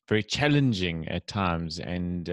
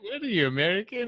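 mock teasing; 13 semitones higher, faster than usual. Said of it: "I'm like".

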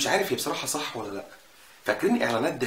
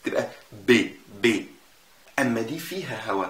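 ordinary talk, nothing marked

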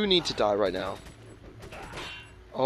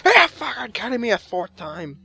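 rising exasperation; 6 semitones higher than usual. Real, not acted. Gamer dying repeatedly, exasperation building to a yell.

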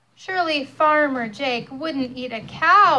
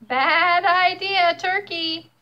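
higher, louder, slow scolding rooster; stern rooster; 7 semitones higher, 6 dB louder, slower than usual. Acted.

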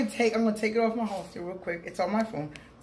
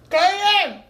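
calling out; 11 semitones higher, 7 dB louder, slower than usual. Real, not acted. Calling grandson.

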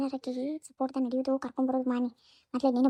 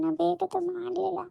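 tearful denial; 5 semitones higher, slower than usual. Acted. Crying denial, first time.

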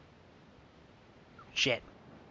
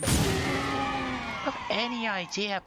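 drawn out, pitched higher, mock outrage; hammed-up indignation; 9 semitones higher, slower than usual. Gamer re-taunting his killer, hammed-up slow higher outrage. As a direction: stretch it like a pompous diva.

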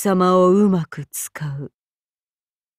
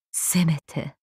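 hushed, softly spoken threat; gentle menace; 2 semitones lower, 7 dB quieter than usual. Acted. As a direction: promise death almost tenderly, very quietly. Softly promises a gentle death. (優しく静かに殺意を告げる声)

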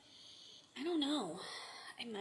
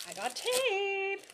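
excited; 9 semitones higher, slower than usual. Real, not acted. Delighted at finding tape.

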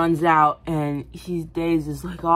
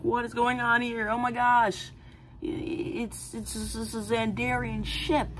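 put-on higher, amazed young hero; a hint of a wide-eyed teen; lightly voiced teen; 7 semitones higher, 4 dB quieter than usual. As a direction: play the kid in awe. Richard voiced in retelling.

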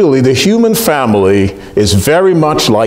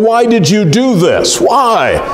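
higher, a lightly voiced fading plea; dying frog pleading; 7 semitones higher, slower than usual. Dying frog asks the scorpion why, higher pleading voice. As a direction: ask why with the last breath of a stung frog. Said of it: "in this last bit of consciousness".

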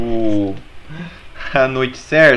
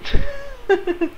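squeaky high laugh; 4 dB quieter than usual. Acted. Laughs after evil joke.

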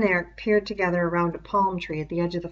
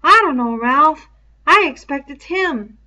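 put-on higher, louder boyish voice of a puzzled kid; an earnest schoolboy thinking out loud, pitched up and loud; Piggy, puzzled; 8 semitones higher, 11 dB louder than usual. Piggy puzzling aloud to Ralph, higher and louder boy voice.

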